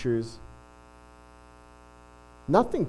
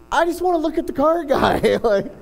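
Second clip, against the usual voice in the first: high, loud mock plea through laughter; amused, pitched-up exasperation; laughing exasperation; 12 semitones higher, 5 dB louder than usual. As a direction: protest comically, breaking into laughs. Laughing exasperation at own story.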